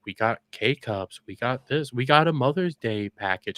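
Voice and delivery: slow, low mock-pitchman drone; boring sales pitch. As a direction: imitate a tedious coffee plug, dragging it out. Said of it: "'Cause it's so boring".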